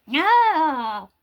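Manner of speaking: high squeaky pouting no. Pretend. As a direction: squeak a stubborn no. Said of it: "You don't like your squeaky voice".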